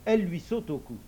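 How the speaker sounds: plain reading voice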